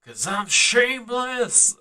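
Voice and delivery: lightly voiced rural Southern accent, sung; Southern country twang on a slow sung line. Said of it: "I said it in a hick accent".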